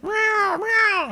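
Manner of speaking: very high imitated meows; high drawn-out mock meows; cat meow. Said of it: "says the cat".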